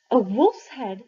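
gasped, horrified. Acted. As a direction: blurt it out in shock, breath caught. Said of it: "I gasped, horrified".

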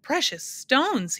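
slow, high, drawn-out roar. A narrator's reading. Math magician answering Milo, a slow roar. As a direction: stretch each word into a big roar. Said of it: "he roared, even louder than before".

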